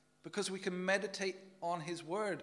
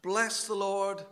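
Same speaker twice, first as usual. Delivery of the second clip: loud, slow, ringing recital of the psalm; proclaiming scripture; 2 semitones higher, 7 dB louder, slower than usual. Acted. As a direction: declaim each line like a call to worship. Preacher recites Psalm 103.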